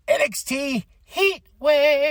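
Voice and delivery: high, drawn-out announcer-style shout; sing-out hype on the show title; hyped event call. Real, not acted. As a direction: stretch the event name way out, pitched high.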